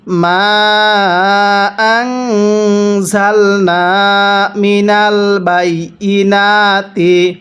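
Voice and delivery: slow, drawn-out tajweed chanting; slow Quran recitation. Shown as a demonstration. Teacher demonstrating recitation to students, slow chant. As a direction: recite each syllable long and measured. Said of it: "তাহলে কিভাবে পড়ব?".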